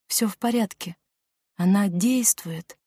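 soft, gentle comfort; tender, hushed assurance; reassuring. Acted. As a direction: soothe a patient quietly. Girl reassures the sick boy.

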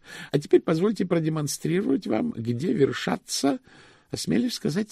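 high, formal voice for a precise clerk; ceremonious high-voiced bank official; high-pitched pedantic chief cashier.

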